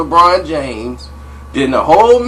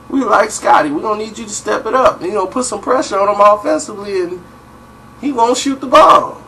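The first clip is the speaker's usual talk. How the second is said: higher and quick, pressing hard; 6 semitones higher than usual. Retold or imitated. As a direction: talk it up fast, urging a teammate. Voicing [name] urging [name].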